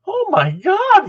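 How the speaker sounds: high, drawn-out shock; aghast. Retold or imitated. Onlooker watching the coach scream, appalled high exclamation. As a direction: stretch it out, high and loud, in disbelief. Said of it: "And I'm going".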